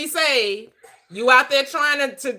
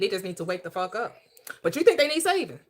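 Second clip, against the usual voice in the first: ordinary delivery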